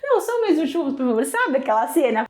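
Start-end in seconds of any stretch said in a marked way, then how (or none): 0.0-1.2 higher mock begging; lightly voiced childish plea for one more; imitating Chaves pleading. Husband mimicking Chaves, begging for just one more churro. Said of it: "cena do Chaves com o churros".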